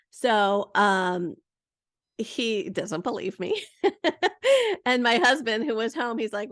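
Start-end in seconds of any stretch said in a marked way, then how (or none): 3.7-4.8 sudden high burst of laughter. Real, not acted. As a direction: laugh out loud at the memory. Laughing at son's disbelief.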